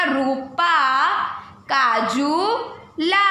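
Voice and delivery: Rupa said slowly with a long drawn रू; slow pronunciation. Shown as a demonstration. Teacher showing children how to pronounce a name, slow. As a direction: pronounce it clearly for the class. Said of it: "अब इसे pronounce करेंगे तो क्या होगा?".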